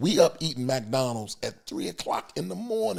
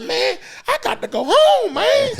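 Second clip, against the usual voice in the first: much higher, loud whine; high mock desperate driver; driver begging off; 7 dB louder than usual. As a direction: wail it to get away. Driver refusing to hang.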